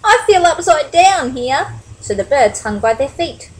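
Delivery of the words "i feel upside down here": high, loud little-bird character; lifted, animated character voice; Flap's voice. A narrator's reading. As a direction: complain as a small storybook bird. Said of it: "Flap said".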